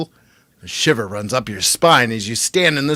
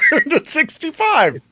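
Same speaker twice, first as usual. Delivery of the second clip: reading through laughter; real laughter breaking the read; laughing. Real, not acted. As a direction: giggle out the grim option. Laughs while reading choice.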